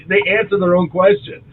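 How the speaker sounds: usual speaking voice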